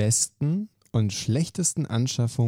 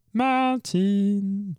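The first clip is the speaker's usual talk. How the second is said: drawn out and high, calling from afar; long calling out; 9 semitones higher than usual. Real, not acted. Host summons Martin across the room, drawn-out call. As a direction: stretch the name to reach him. Host calls colleague over.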